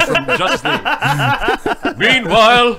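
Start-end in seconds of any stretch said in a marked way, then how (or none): 2.0-2.8 loud mock narrator; cartoon announcer. Host parodying Justice League cutaways, loud mock announcer. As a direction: boom it out like a scene-change announcer.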